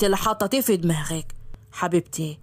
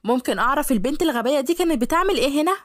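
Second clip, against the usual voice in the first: jealous Yara; 7 semitones higher than usual. Acted. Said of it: "فبصت له يارا".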